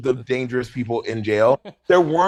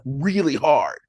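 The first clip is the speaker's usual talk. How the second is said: usual speaking voice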